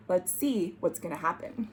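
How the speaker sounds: usual speaking voice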